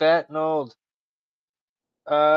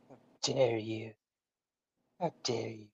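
Greta impression; 4 semitones lower, 12 dB quieter than usual. Mocking Greta, quiet far voice.

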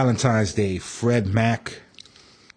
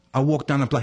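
ordinary talk

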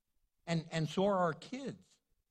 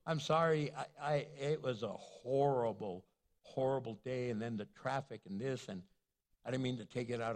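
tired apology; 8 semitones lower than usual. Retold or imitated. Wife apologizing, retold.

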